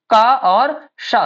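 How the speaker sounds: का drawn out slowly, pause, then शा; separate k and sh. Shown as a demonstration. Teacher explains what kṣa is made of, slow का and शा. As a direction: stretch का and शा apart as two sounds. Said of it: "उच्चारण भी इसी हिसाब से करना है".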